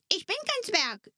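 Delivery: small creature insisting it is no dwarf; indignant little creature. Acted. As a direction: bristle at being called a dwarf. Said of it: "empörte sich das Männchen".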